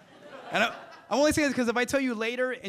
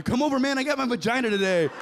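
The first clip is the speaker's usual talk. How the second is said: loud, higher hyped shout; excited call; 5 semitones higher, 5 dB louder than usual. Acted. Guy phones his buddy to come over, loud hyped shout. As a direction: brag to a buddy at full volume.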